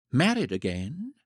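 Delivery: wide-eyed, innocent query; innocent puzzlement. A narrator's reading. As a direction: echo it as if not understanding. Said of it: "apparently not understanding".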